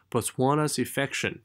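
"Botswana's" lifted, "infection" falling; rise then fall. Shown as a demonstration. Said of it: "一升一降".

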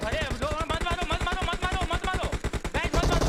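loud, high, repeated plea to stop; urgent shouting. Real, not acted. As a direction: yell at teammates to hold fire. Urgently stopping teammates from shooting.

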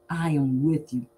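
usual speaking voice

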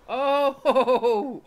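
sudden high, loud frightened yelps breaking into a laugh; real fright, shrieking; startled scream. Real, not acted. Gamer jolted by a jump scare, yelping in fright.

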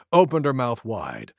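plain reading voice

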